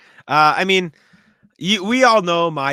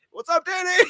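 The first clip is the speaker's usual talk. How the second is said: hollered greeting. Retold or imitated. Pizza owner greeting a regular over the music, high holler.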